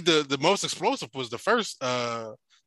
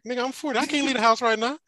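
higher-pitched incredulous refusal; mock protest; 8 semitones higher than usual. Married man turning down his friend, mock incredulous. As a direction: push back in disbelief, acting it out.

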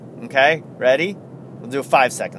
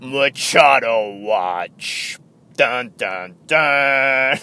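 dramatic announcement; much slower than usual. Pretend. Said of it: "let's do it. Ready?".

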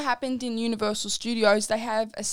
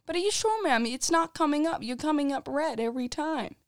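high, skeptical staff voice; mock doubtful cast member; 5 semitones higher than usual. Cast member doubting the twin at the gate, high mock voice. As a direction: play the staffer doubting her.

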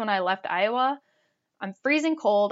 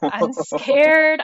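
scared; 5 semitones higher, 8 dB louder than usual. Retold or imitated. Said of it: "I'm crying at this point".

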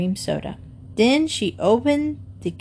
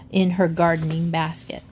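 ordinary delivery

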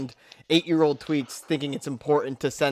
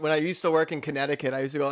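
ordinary delivery

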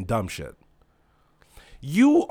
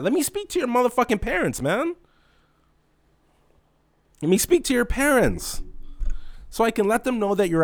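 mock scolding; 11 semitones higher than usual. Scolding rude customers.

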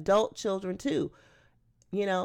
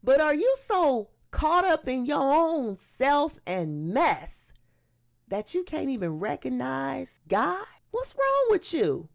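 indignant, higher-pitched scolding; checking someone; 4 semitones higher than usual. Acted. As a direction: call him out, rising to a high, incredulous rebuke. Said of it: "another criminal that checked another criminal".

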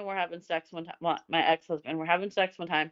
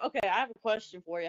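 ordinary talk

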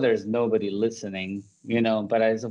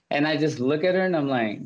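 normal talking voice

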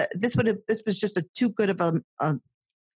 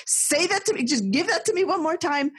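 incredulous; 9 semitones higher than usual. Retold or imitated. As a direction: whip round in mock disbelief. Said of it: "whipped her and I'm like".

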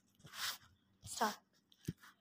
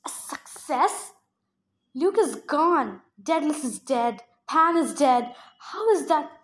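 slow, louder, bitter protest; angry disbelief; 5 dB louder, slower than usual. Acted. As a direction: hurl each loss at the goddess. Percy confronts Hera over the dead.

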